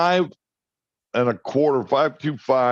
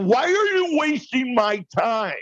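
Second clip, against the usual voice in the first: higher, mock-exasperated rant; exasperated outburst; 11 semitones higher than usual. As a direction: blow up at her, half joking. Retold outburst at wife over dinner.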